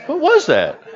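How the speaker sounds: surprised laugh at a sudden noise; startled surprise. Real, not acted. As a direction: react to a strange sound in the room.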